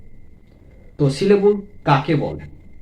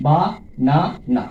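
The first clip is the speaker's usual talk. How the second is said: বা, না, না as three separate beats; teacher sounding out বা ... না ... না; split into syllables; 3 dB louder than usual. Shown as a demonstration. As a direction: pause after each of বা না না. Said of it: "তিনটি সিলেবল রয়েছে".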